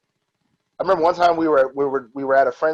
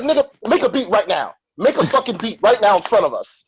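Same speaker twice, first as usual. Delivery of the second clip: aggressive demand; 6 semitones higher than usual. Retold or imitated. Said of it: "he was like".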